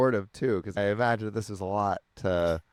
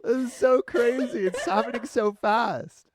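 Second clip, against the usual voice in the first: laughing through tears; 12 semitones higher, slower than usual. Real, not acted.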